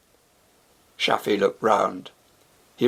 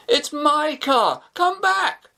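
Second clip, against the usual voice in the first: a high, slow, desperate call after the car; yelling after; slower than usual. Acted. Shafi yelling after his stolen car, desperate. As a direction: yell down the road in vain. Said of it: "No good yelling after 'em".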